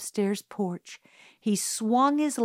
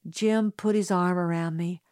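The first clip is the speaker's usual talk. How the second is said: ordinary delivery, nothing marked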